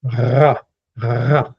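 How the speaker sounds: "ra" with the R rolled on the uvula; long throaty trill starting "ra"; uvular trilled R. Shown as a demonstration. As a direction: vibrate the uvula on the R of "ra". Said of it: "sometimes it's even trilled back there".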